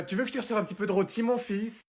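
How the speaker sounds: high, soft, quick offer; put-on motherly sweetness; doting mother. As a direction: pitch it up sweetly, changing the subject. Mother offering roast after outburst.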